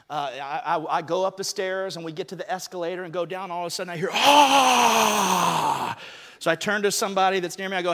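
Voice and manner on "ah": a loud "ah" like a crowd cheering far away; distant crowd roar. Retold or imitated. Telling his wife the story, mimicking the stadium's cheer.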